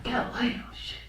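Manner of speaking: whispered, stunned echo; barely audible bewilderment; hushed disbelief. Acted. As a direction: whisper it as if utterly lost. Stunned at the Jeopardy answer.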